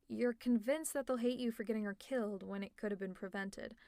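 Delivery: ordinary talk, nothing marked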